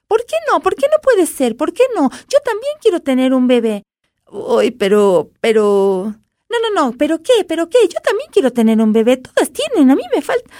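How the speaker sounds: high, whiny tiny doll. A narrator's reading. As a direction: whine like a spoiled doll.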